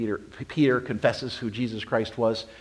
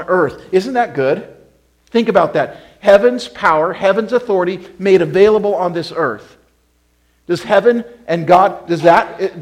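loud, soaring pulpit voice; exultant preaching; preaching at full force; 5 semitones higher, 10 dB louder than usual. Acted. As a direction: lift the room with it. Preacher exalts heaven's power.

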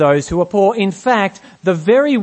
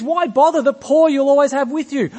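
higher, mock dismissive scoff; harsh, callous; 6 semitones higher than usual. Preacher mocking people who twist Jesus' words, dismissive. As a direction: lightly imitate a callous shrug-off of the poor. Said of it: "make Jesus sound harsh and callous and dismissive".

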